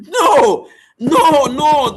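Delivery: loud, high, insistent no; emphatic no. Real, not acted. Speaker urging listeners to reject the thought, loud and insistent. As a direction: cut the thought off hard, loudly.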